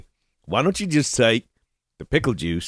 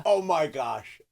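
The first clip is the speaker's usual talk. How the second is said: mock emotional; 4 semitones higher, 6 dB quieter, slower than usual. Real, not acted. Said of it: "I'm getting emotional about this".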